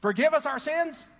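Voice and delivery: high, loud, rousing group reading; with enthusiasm. Shown as a demonstration. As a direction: lift it up with enthusiasm, nearly shouting. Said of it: "with a little enthusiasm".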